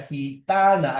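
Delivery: Gitana with the stressed syllable ta pushed and the final a held; Gitana, fuerza de voz en ta. Shown as a demonstration. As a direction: lean on ta in Gitana. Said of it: "ahí está la fuerza de voz".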